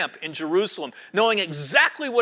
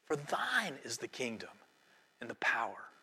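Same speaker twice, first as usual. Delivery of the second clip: soft prayer; 8 semitones lower, 12 dB quieter than usual. Real, not acted. Reciting prayer ending softly.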